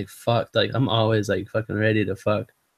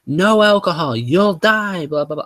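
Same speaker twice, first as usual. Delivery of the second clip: higher, louder hammed-up yell; mock doctor yelling; 6 semitones higher, 4 dB louder than usual. Surgeon screaming at his patient about alcohol, mock yell. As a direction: shout the warning like the surgeon. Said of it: "he kept screaming at me".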